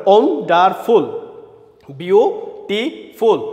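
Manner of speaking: "Wonderful, beautiful" each in three separate beats; split into syllables. Shown as a demonstration. Teacher dividing words into three parts, "wonderful, beautiful" syllable by syllable. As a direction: break "wonderful" briefly, "beautiful" with longer pauses. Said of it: "ভাগ করে আমরা উচ্চারণটা করতে পারি".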